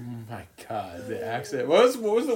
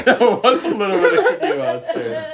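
laughing; 9 semitones higher, 7 dB louder than usual. Real, not acted. Laughing while answering.

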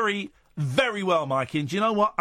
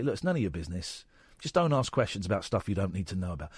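put-on low, firm dad telling kids off; a hint of a stern father; 10 semitones lower, 4 dB quieter than usual. Dad voiced in story.

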